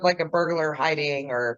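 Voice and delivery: normal talking voice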